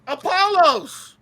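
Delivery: a scoffing, drawn-out Apollos, like you can't believe anyone wrote that; incredulous echo. Shown as a demonstration. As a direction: echo Apollos in mock disbelief, high and slow.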